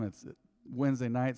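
ordinary delivery

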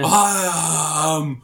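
slow samurai voice. Retold or imitated. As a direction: stoic samurai line. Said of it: "you know what they replaced them with".

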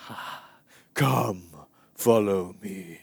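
slow, airy, drawn-out mock-holy voice; ethereal movie Jesus. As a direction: float the words out dreamily, very slowly. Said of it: "Jesus is basically, like, floating along".

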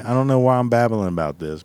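ordinary talk

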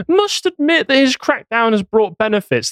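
through gritted teeth. Acted. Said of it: "they say through gritted teeth".

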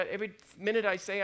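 ordinary delivery

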